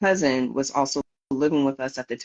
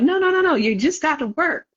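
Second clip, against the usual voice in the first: hurried protest; 12 semitones higher than usual. Retold or imitated. Stopping husband coming home.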